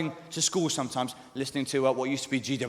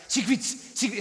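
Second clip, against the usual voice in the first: put-on high South African accent, vowels vanishing; 7 semitones higher than usual. Comic mimicking South Africans saying cigarettes, South African accent. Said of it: "The vowels just sort of disappear".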